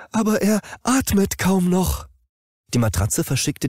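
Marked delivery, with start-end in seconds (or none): 0.1-2.1 much higher, anxious machine voice; worried mattress pointing out the dying man; alarmed mattress voice. Acted. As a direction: plead it in panic as the mattress. Mattress reports dying owner.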